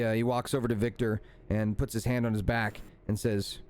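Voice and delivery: ordinary talk, nothing marked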